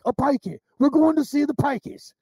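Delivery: Gorgeous George. Retold or imitated. Retelling a movie line about the pikeys, imitated accent. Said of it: "that's the way this guy talked".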